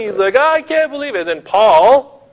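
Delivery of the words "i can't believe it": high, loud acted wail; weeping wail. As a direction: cry it out in mock despair. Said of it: "he's weeping".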